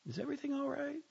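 high, cautious female voice; a puzzled partner; wary wife on phone. A narrator's reading. Sophie asks on phone.

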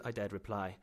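plain reading voice

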